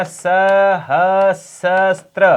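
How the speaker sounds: सहस्त्र drawn out syllable by syllable; slow syllable demo. Shown as a demonstration. Teacher spelling out सहस्त्र slowly for students. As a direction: sound out सहस्त्र very slowly, each syllable clear. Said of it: "ये शब्द ध्यान रखना".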